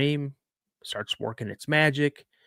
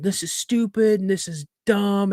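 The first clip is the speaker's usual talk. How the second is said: mock tantrum; 8 semitones higher than usual. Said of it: "losing my seven-year-old mind".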